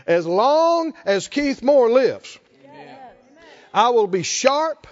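slow, emphatic preaching; preacher's declaration. Real, not acted. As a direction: proclaim it with drawn-out conviction.

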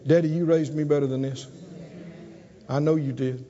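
low, soft plea to daddy; ashamed son. Acted. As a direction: confess quietly, head bowed in the voice. Son confesses to his father.

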